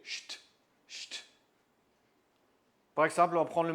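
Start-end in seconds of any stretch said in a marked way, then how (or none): 0.0-1.2 "Cht" as a hushed sh closed by a crisp t; sht sound. Shown as a demonstration. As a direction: demonstrate "cht", sh plus t, no vowel. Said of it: "c'est comme ch avec un T". (« cht » soufflé, ch suivi d'un t)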